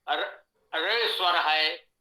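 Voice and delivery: र drawn out slowly as a vowel; vowel र demo. Shown as a demonstration. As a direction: model र slowly between ई and ऊ. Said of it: "जैसे". (र का धीमा उच्चारण)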